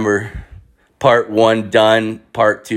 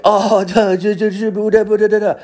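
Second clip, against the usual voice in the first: mocking French gibberish; 13 semitones higher than usual. French family jabbering at him, mocking high babble. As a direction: imitate a foreign family chattering in a high silly voice. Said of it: "it's not English ... It's fucking French".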